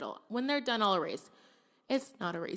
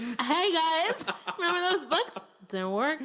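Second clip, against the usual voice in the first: high, louder, laughing announcement; mock cheery post; 8 semitones higher, 5 dB louder than usual. A teacher imagining telling followers the books failed, cheery and laughing. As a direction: play up a cheerful confession. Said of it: "I probably should be like".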